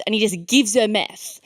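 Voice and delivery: ordinary talk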